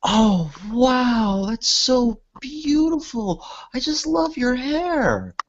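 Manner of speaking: put-on very high, slow, gushing girl voice; Sally amazed. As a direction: coo over the hair in wonder. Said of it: "And Sally's gonna say".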